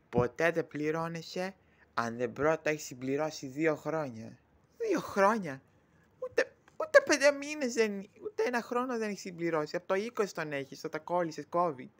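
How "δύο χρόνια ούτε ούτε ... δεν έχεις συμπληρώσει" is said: high, loud incredulous echo; indignant disbelief. Acted. Disputing how long the servant has worked, indignantly. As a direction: pounce on it with rising outrage. Echoes the condition in acted quarrel.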